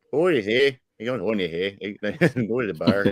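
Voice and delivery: put-on playful upper Michigan accent; mock northern Michigan accent. Co-host imitating talk up the Mitten, northern Michigan accent. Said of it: "you start hearing".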